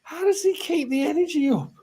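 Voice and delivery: high-voiced awe; high amazed question. Real, not acted. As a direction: ask it in astonishment.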